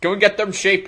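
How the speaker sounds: loud, high Yorkshire farmer voice; Yorkshire accent. Retold or imitated. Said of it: "I can't really do Yorkshire accent".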